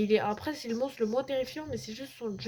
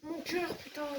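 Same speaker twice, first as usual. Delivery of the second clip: usual speaking voice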